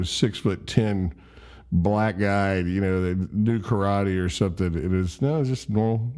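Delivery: ordinary delivery, nothing marked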